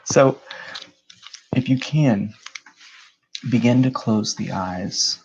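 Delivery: measured meditation guide, long pauses between phrases; guided meditation. Real, not acted.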